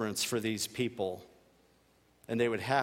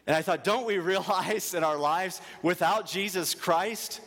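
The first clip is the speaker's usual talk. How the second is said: raised, higher fervent preaching; impassioned preaching; 5 semitones higher than usual. Acted. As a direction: drive the point home to the congregation.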